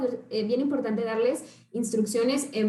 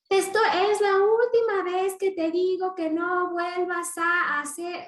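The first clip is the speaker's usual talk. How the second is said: sing-song warning; 7 semitones higher, slower than usual. Retold or imitated. Parent's repeated warning.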